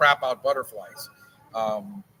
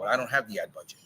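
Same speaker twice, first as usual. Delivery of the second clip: ordinary delivery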